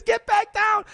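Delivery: high, loud retold command; shouted order. Retold guide orders him down.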